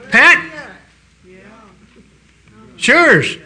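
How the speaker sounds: fervent preacher cry; emphatic proclamation. Acted. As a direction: shout it out like good news breaking over the room. Preacher calls to a congregant.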